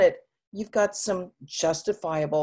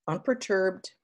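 ordinary delivery

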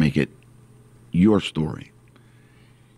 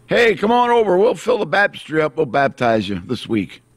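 mock church invite; 9 semitones higher than usual. Preacher mimics a church invitation.

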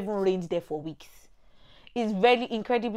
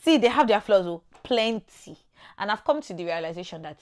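ordinary talk

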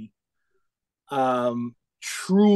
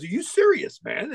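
much higher-pitched mock astonishment; retold disbelief; 7 semitones higher than usual. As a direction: imitate someone who can't believe it. Retold guy reacts in disbelief.

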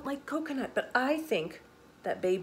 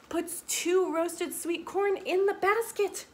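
high, drawn-out storybook reveal; gleeful reveal; 8 semitones higher than usual. A narrator's reading. Storyteller revealing baby's trick to children, gleeful. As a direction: reveal the mischief with big excitement.

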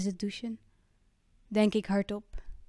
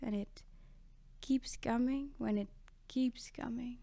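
whispered all through, much softer; soft whispered refrain; 9 dB quieter than usual. Acted. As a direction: let it come out as bare breath. Whispered echo of the chorus.

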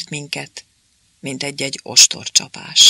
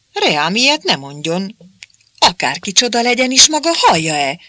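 higher, louder and fast, indignant; indignant outburst; 6 semitones higher, 6 dB louder than usual. A narrator's reading. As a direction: burst out in offended protest. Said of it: "tört ki belőle a méltatlankodás".

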